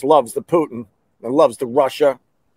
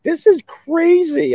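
much higher, slow, awestruck; amazed; slower than usual. Retold or imitated. Watching the coup on TV, high slow amazement. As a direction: gape at the TV in disbelief. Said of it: "we're all in front of the TV like".

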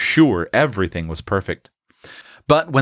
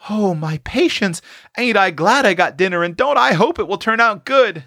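put-on high, flustered country-girl exclamation; exclaiming; 11 semitones higher than usual. A narrator's reading. Tilly sees guests coming for dinner, high excited exclamation. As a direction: exclaim with relief and hope, pitched well up. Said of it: "exclaimed Tilly".

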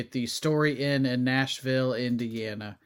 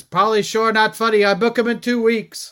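imitating club owner; 7 semitones higher, 6 dB louder than usual. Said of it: "as the former owner would say".

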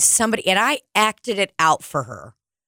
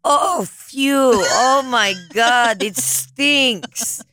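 mock disgust; 5 semitones higher, 3 dB louder, much slower than usual. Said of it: "Like, literally the word phew".

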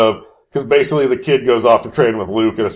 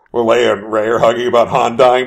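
normal talking voice

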